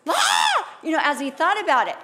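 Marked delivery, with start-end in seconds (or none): 0.1-0.7 high bawled "ah"; hammed-up sudden shriek; mock child's wail. Mother imitating her son crying between sentences, high wail. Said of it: "every other sentence was just".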